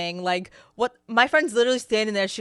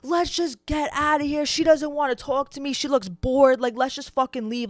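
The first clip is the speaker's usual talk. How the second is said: retold screaming; 5 semitones higher than usual. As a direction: imitate a drunk man's screamed complaint. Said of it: "He starts screaming".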